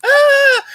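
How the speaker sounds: very high loud cartoon shriek; cartoon electrocution scream. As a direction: scream like a zapped cartoon character. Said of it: "like a cartoon character".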